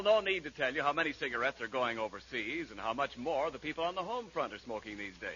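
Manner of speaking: normal talking voice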